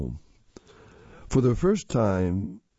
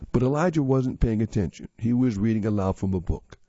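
usual speaking voice

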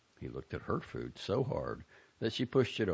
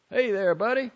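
called out much higher and louder, strained; 9 dB louder than usual. A narrator's reading. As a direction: call out nervously, voice tight. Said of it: "called out in a strange, strained voice".

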